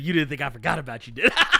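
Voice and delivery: quick, cocky movie-hero taunt rising to a laughing shout; Blade taunt. Retold or imitated. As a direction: throw the line like a hero's comeback. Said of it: "He said".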